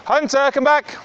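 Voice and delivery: loud, high call across the field; calling the dogs. Real, not acted. Owner calling her dogs back on a walk, loud high call. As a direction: shout them back to heel.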